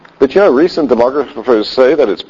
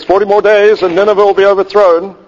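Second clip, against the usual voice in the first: proclaiming doom; 7 semitones higher than usual. Retold or imitated. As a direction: cry the warning out to the city. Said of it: "Guy walks into town and says".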